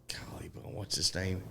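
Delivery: unvoiced whisper to himself while searching for a name; soft whispered mutter, half to himself; whispered aside. Real, not acted. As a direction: breathe it under your breath, trying to remember. Trying to recall plumber's name.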